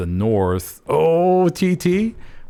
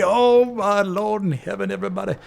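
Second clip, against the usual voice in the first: amazed; 10 semitones higher than usual. Real, not acted. Caster telling viewers about Sugar Squad, amazed outburst. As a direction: gasp it out to the audience.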